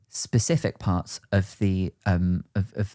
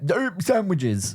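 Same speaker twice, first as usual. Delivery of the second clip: dopey higher sitcom dimwit, drawn out slowly; dumb-guy voice, stretched and pitched up; Joey impression; 6 semitones higher, much slower than usual. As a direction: do a slow-witted, food-loving lunk. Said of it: "that's a pretty good impression".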